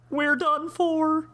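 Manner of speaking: high, slow doomed wail; Shaggy voice. Acted. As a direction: moan it like a scared Shaggy. Shaggy despairs.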